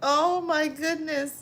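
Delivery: very high, laughing exclamations; loud laughing. Real, not acted. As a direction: squeal it out between laughs. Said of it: "my laugh is loud".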